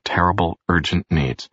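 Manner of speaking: plain reading voice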